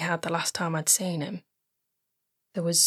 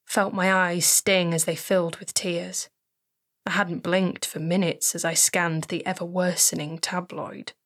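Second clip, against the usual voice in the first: plain reading voice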